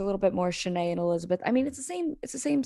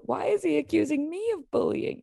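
mock indignant whine; 9 semitones higher than usual. Voicing contestant's complaint.

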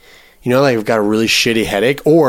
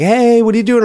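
high-pitched imitation of a friend calling in; imitated cheery greeting; 12 semitones higher, 4 dB louder, faster than usual. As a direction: pitch it way up, voicing her casual hello. Retelling friend's greeting at tent.

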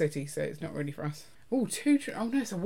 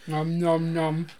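slow munching sounds; chewing noisily; mouth full; 2 semitones lower, much slower than usual. Real, not acted. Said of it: "I can't stop eating".

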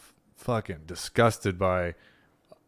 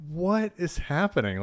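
retold disbelief; 9 semitones higher than usual. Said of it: "I'm like".

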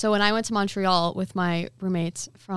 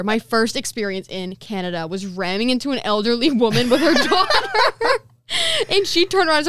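breaking into laughter; 2 semitones higher, 4 dB louder than usual. Real, not acted. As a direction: lose it laughing as you finish. Laughs while telling her own mishap.

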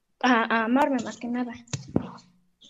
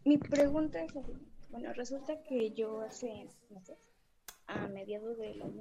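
faint, too far from the microphone; far from mic; 12 dB quieter than usual. Real, not acted. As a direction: speak from across the room. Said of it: "No, mi amor, no te escucho".

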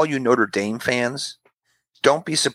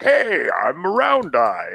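mock cartoon voice; 3 semitones higher, much slower than usual. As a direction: shout it like a goofy mascot. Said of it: "I go".

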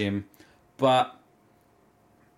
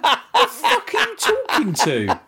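high, louder laughing question; laughing disbelief; 6 dB louder, slower than usual. Retold or imitated. Baffled by the worker talking to no one, laughing. Retold confused outburst.

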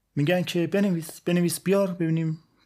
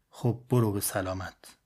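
put-on old father; 6 semitones lower, 3 dB quieter than usual. Father's replies to his excited son.

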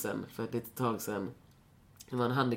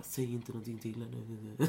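frightened murmur; 9 dB quieter than usual. Retold or imitated. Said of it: "de bara".

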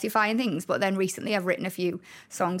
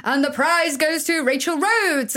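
slow, louder award announcement; mock award-show announcer; 6 semitones higher, 5 dB louder, slower than usual. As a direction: announce it like a host at a prize-giving. Announcer reading the prize.

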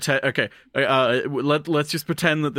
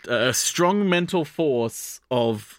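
ordinary delivery

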